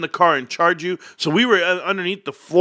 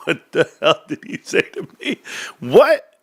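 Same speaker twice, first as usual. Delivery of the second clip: retold stunned reaction to an insult; incredulous. Retells his disbelief at the insults.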